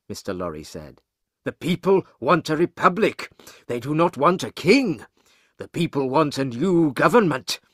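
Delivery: story character breaking momentous news; urgent news-bearer. Acted. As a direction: deliver dramatic news with rising urgency. Banker reports the revolution in Paris.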